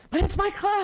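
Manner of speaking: pinned to the wall during shooting, squeaky protest; high-pitched protest. Retold or imitated. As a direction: protest like a shocked kid held back from her own club. Held back during gunfire.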